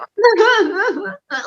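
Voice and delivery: high, overdone fake sob; mock sobbing. Mocking actors who sob on their reels, overdone fake sob. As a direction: imitate an actor's showy crying. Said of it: "like that kind of crying".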